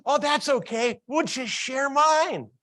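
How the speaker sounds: put-on high child voice, slowing at the end; high, drawn-out kid reassurance; easygoing kid. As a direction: offer it like a generous little boy. Acts boy offering to share.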